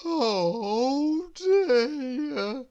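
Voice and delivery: a high dazed groan. A narrator's reading. Mister Lazy groaning in a daze at the chores. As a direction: sound half asleep and overwhelmed. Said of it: "groaned Mister Lazy in a daze".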